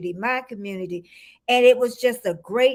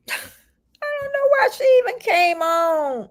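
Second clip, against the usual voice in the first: high-pitched, laughing disbelief; 9 semitones higher, 3 dB louder than usual. Real, not acted. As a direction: say it through a real laugh, voice riding up high. Laughing at Siri switching on.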